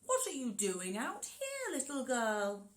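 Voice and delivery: a wolf faking niceness; sly wolf pretending to be nice; friendly wolf voice. Shown as a demonstration. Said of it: "What's your best friendly wolf voice".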